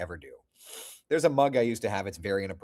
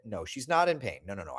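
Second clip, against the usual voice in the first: ordinary delivery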